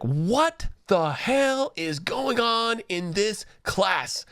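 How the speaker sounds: high, slow mock outrage; mock-outraged mother voice; angry mother. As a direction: play the furious mom, drawn out and high. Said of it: "she's like".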